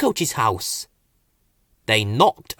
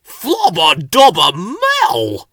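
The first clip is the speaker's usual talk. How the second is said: loud, slow wrinkled-nose burble; sniffing gibberish; 5 semitones higher, 8 dB louder, much slower than usual. A narrator's reading. Bill smelling muddy Ben, slow loud gibberish. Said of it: "he said".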